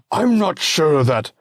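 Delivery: high, loud plea; protesting senator. Acted. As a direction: cry out in protest. Olympius cut off.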